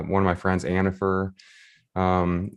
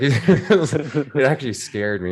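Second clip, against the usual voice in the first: laughing; 6 semitones higher, 3 dB louder, slower than usual. Real, not acted. Laughing while recalling fright.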